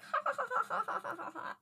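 high, forced polite laughter; high fake laugh that fades out; mock laugh. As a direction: imitate people laughing it off awkwardly. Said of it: "And they're like".

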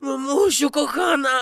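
high, tearful, drawn-out cry; wailing. Acted. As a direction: wail for mummy at full stretch. Wailing for mummy, cry heard.

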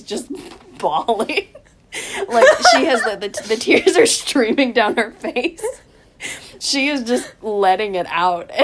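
high, loud words breaking into laughter, slowing down; laughing while telling. Real, not acted. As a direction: try to tell it through your giggles. Laughing while describing friend bawling.

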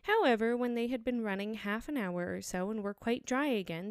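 ordinary delivery, nothing marked

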